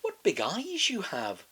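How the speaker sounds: put-on high, slow child in awe; wondering little girl. A narrator's reading. Girl noticing grandma's eyes, slow wondering child. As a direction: say it slowly, wide-eyed.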